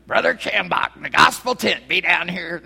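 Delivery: loud radio revival preacher impression; booming southern gospel-radio announcement, put on; Brother Schambach. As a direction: shout it like an old radio tent evangelist. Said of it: "what you used to hear on the radio".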